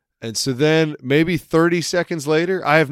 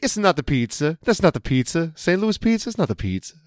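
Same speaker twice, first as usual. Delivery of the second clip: put-on Italian accent; 5 semitones higher than usual. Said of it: "And he's like".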